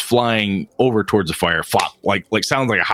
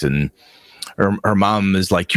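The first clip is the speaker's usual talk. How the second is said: ordinary talk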